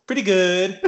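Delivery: high, loud squeaky comic voice; put-on high voice for the punchline; cowed punchline reply. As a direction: imitate a would-be hero suddenly backing down. Bobby's meek reply, punchline acted.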